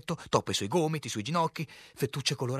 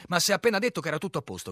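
fast, loud, raised objection; shouted protest; 8 semitones higher, 7 dB louder, much faster than usual. A narrator's reading. Captain challenging the harlequin's assurance, fast shouted protest.

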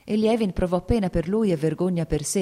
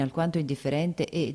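ordinary delivery, nothing marked

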